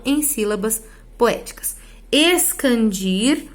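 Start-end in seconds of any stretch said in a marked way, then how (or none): 2.1-3.4 very slow, stretched-out "Escandir"; drawn-out term. Shown as a demonstration. Teacher introducing the term escandir to students, drawn out. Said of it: "Denomina-se escandir ao processo".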